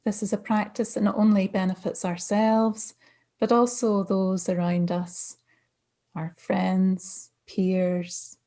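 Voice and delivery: calm, unhurried meditation guidance with long pauses; guided meditation. Real, not acted. Mindfulness coach settling listeners into practice, calm meditation voice. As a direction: ease listeners gently into stillness, phrase by phrase.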